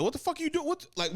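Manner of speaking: high-pitched, indignant objection; exasperated protest. Acted. Tired lineman objecting to the short rest, exasperated. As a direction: protest in disbelief, voice shooting up.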